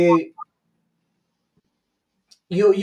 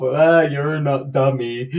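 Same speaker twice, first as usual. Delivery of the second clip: teasing tell-off; slower than usual. Retold or imitated. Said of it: "we do tell each other off".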